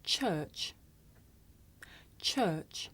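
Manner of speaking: Church. said softly and slowly, each ch clear; quiet careful demo. Shown as a demonstration. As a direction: show the lip shape on Church.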